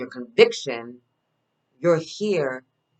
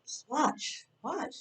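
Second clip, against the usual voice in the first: high soft tease; 11 semitones higher, 9 dB quieter, much slower than usual. Acted. Teasing follow-up to the echo.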